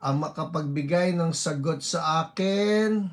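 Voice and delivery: usual speaking voice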